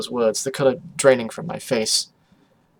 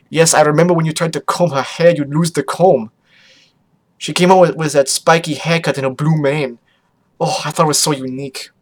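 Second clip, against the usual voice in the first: louder, lightly theatrical dad voice; reminiscing father character; 2 semitones higher, 6 dB louder than usual. Acted. Father teasing daughter over her hair, lightly voiced gushing. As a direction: play a dad recalling fond stories.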